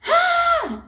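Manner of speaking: piercing high scream of panic. Retold or imitated. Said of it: "This was panic screaming".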